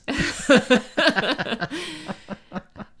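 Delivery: uneasy nervous giggle; nervous laugh. Real, not acted. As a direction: laugh off the tension. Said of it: "this is nervous laughter".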